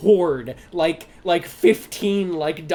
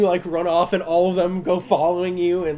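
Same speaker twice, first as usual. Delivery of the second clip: ordinary talk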